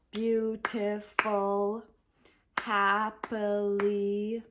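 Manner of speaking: beautiful and happily drawn out slowly, syllable by syllable; slow syllable demo. Shown as a demonstration. Teacher to English learners, slowly spelling out syllables. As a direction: stretch each word out so learners count the syllables. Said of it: "those are three syllable words".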